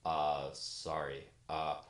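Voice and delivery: low, slow, sheepish stammer; low hesitant sorry; sheepish apology. Acted. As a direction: apologize like someone caught daydreaming. Husband caught out by wife.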